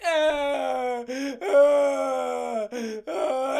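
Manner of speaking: loud, drawn-out imitated wails; hammed-up boyish wailing; mock crying. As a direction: bawl in long mock sobs. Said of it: "like, a sad boy cry".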